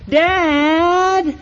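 put-on high, loud, drawn-out child's cry; imitating a tattling sister. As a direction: call out like a little sister telling on her brother. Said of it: "cry out in a very loud voice".

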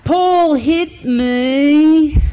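put-on high, loud, drawn-out child's cry; imitating a tattling sister. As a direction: call out like a little sister telling on her brother. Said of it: "cry out in a very loud voice".

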